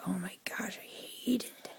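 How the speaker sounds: mock whispered "I hate it"; whispered grumble. Mimicking holiday haters in a whisper. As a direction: breathe it out as a mocking whisper. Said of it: "And, like".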